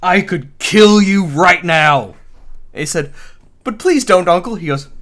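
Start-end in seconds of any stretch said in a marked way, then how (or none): 0.0-2.2 slow, loud, emphatic threat, imitated; retold threat. Retold attacker threatening the king. 3.6-4.6 high, loud imitated plea; hammed-up appeal for mercy; mock pleading. Retold nephew pleading with uncle.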